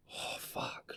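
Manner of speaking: breathy, whispered curse to himself; retold sigh of dread under the breath; dismayed whisper. Voicing his dismayed private reaction.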